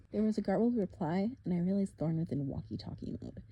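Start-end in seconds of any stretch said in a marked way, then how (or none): none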